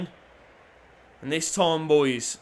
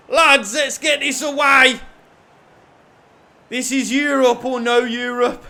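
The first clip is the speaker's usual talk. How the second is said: higher, louder plea to the team; urgent shout; 6 semitones higher, 8 dB louder than usual. Real, not acted. Defending a ninetieth-minute corner, shouting at his players to clear. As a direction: call out to the lads to clear it.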